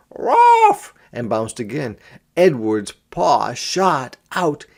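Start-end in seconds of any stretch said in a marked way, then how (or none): 0.1-0.9 high, loud yappy puppy bark. A narrator's reading. Narrator barks as the puppy facing the kitten. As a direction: bark sharply like a small puppy. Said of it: "He gave a third".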